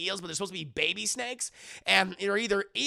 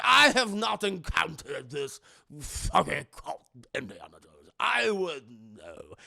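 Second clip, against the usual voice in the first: colonel voice; 4 semitones lower, 3 dB louder than usual. Retold or imitated. Colonel voiced in movie recap.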